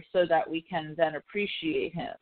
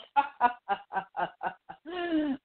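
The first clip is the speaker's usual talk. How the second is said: long, higher-pitched laugh; reaction laugh; 7 semitones higher, 3 dB quieter than usual. Retold or imitated. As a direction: burst out laughing at the irony. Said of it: "And I'm like".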